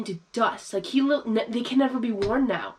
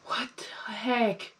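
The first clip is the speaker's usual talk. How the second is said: whispering; much slower than usual. Real, not acted. Shocked reaction to scene.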